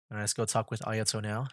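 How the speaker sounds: ordinary delivery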